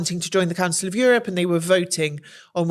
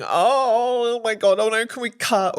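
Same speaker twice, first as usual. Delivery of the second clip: mock panic; 3 semitones higher, slower than usual. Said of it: "freaking out and going".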